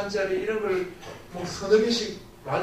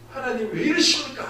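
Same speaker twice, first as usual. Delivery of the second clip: louder, higher cry of frustration at God; retold angry outcry; 6 semitones higher, 5 dB louder than usual. Sick pastor crying out to God in anger, louder and higher. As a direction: protest to God in pain, raised voice. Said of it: "화가 나는 거예요".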